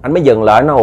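plain reading voice

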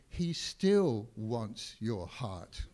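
slow, deliberate; said to neighbour. Shown as a demonstration. Preacher leading congregation, slow deliberate repeat. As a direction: say it slowly to your neighbour. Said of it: "look at them again and say".

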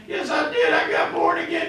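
high, whimpering mock panic; hammed-up distressed high voice; panicking guy. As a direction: answer as the frightened dying man. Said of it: "one of them panicking guys".

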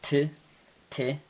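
"T" as the bare consonant sound; vowelless T. Shown as a demonstration. Teacher demonstrating the pure sound of T, without a vowel. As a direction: say "T" avoiding any full vowel, twice, separated. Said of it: "no se pronuncia con vocal".

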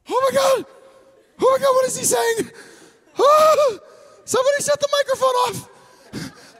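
God yelling in mock alarm to shut the mic off; panicked God. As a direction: play God horrified at the preacher. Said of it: "Can you imagine if God was like".

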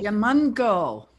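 Giamanco said slowly and loudly; name demonstration. Shown as a demonstration. Guest showing host how to say her name, Giamanco drawn out slowly. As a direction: draw out Giamanco clearly, falling at the end.

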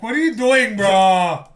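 exasperated shout. Real, not acted. Friend scolding his stupid buddy, exasperated shout. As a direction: yell it at your buddy in disbelief.

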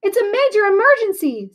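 put-on a frightened girl screaming for help; panicked scream. A narrator's reading. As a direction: shriek it down the phone in a panic. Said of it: "someone screamed".

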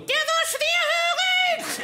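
slow, high, loud shout. Acted. Explaining loudly why she shouts, slow high yell. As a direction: yell it slowly for the hard of hearing. Shouting on about the deaf man.

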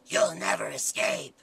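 low, slow, whispered demon. Acted. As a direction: breathe it out like a demon. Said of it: "which character is this? This is Demon Five".

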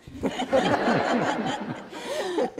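high, loud condescending laugh; smug laugh. Acted. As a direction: laugh at him, amused and superior. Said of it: "Erica laughed".

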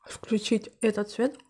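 ordinary talk

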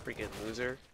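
normal talking voice

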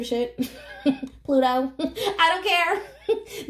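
cracking up mid-rant; real giggles breaking into a high, drawn-out finish; laughing. Real, not acted. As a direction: laugh through the words.

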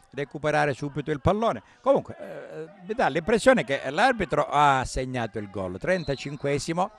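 ordinary delivery

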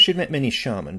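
usual speaking voice

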